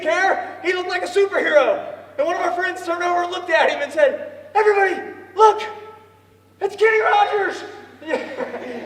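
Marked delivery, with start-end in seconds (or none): 4.5-7.6 louder, higher call to the room; excited announcement. Retold or imitated. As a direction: announce a celebrity's arrival. Friend announcing to everyone.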